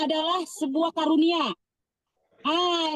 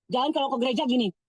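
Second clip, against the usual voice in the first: usual speaking voice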